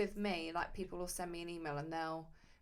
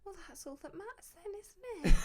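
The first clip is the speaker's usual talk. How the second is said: high, then louder at the end; patronising; 13 semitones higher, 8 dB louder than usual. Shown as a demonstration. Tattooist trying the trick, patronising and rising loud. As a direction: sweetly talk down, then burst out. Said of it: "you sound patronising".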